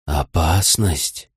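very slow, low wolf voice; slow, hushed animal warning; whispering wolf. A narrator's reading. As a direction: draw the word out low as a wary wolf's warning. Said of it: "прошептал ночной волк".

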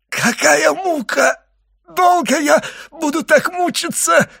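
high, strained voice building to a wail; agonised choking. A narrator's reading. Dying servant chokes in a fit, agonised cries. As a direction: start strangled and faint, build to loud agony.

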